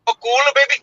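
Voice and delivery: high-pitched mock Indian accent; playful Indian-accent catchphrase. Shown as a demonstration. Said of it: "in an Indian accent".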